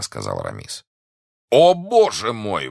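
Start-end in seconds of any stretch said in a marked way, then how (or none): 1.5-2.7 high, loud, drawn-out exclamation; exclaiming. A narrator's reading. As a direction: cry out slowly with raised pitch. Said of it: "воскликнул Портос". (протяжное громкое восклицание)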